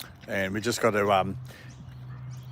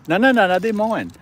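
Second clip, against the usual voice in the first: high, loud playful no; playful scolding; 10 semitones higher, 6 dB louder than usual. Real, not acted. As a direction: fend off the dog, laughing it off. Guarding food from the dog.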